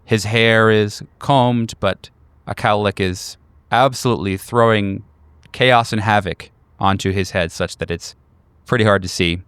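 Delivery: ordinary talk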